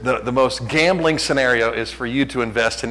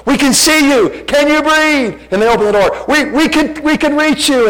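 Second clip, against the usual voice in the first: high, loud rescuer calling out; rescuer shouting; 13 semitones higher, 6 dB louder than usual. Retold or imitated. Pastor voicing the rescuer in a 911 ad, shouting. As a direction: call out to the victim like a rescuer. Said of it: "He goes".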